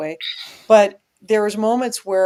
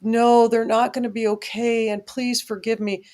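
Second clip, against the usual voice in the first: pleading; 3 semitones higher than usual. Retold or imitated. Retelling his phone call to mom and dad, pleading. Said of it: "pleading and saying".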